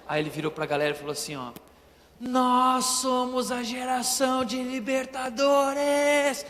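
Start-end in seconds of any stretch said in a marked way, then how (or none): 2.2-6.4 slow, drawn-out rallying proclamation; preacher's climax. Retold or imitated. Pastor at the congress rallying the youth, slow and grand. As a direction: proclaim it to stir the crowd.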